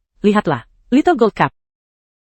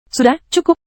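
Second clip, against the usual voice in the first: high call to stop; 8 semitones higher than usual. Acted. Stopping them again.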